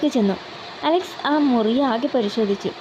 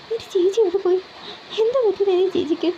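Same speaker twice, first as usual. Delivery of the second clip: close to tears; 8 semitones higher than usual. Acted. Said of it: "Mitra കരയാൻ തുടങ്ങി".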